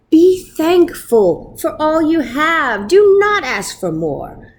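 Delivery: slow solemn rabbi's warning. A narrator's reading. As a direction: pronounce it like a grave holy man. Wonder rabbi gives solemn answer.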